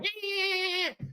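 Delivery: very high, soft squealing yeahs; screechy high cat-like yeah yeah; cat-like yeowl. Shown as a demonstration. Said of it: "'Cause you hear me going".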